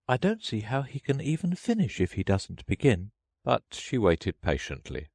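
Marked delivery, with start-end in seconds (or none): 0.1-3.1 put-on high, light girl's voice; Alice's private thought. A narrator's reading. Alice privately doubting the turtle will begin, in a high girlish voice. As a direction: think it to yourself in a much higher voice. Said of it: "Alice thought to herself".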